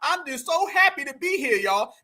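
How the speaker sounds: hammed-up awards-night thanks; mock acceptance speech. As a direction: imitate a grateful award winner. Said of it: "listen how he's talking".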